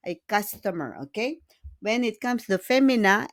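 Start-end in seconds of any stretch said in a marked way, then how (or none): none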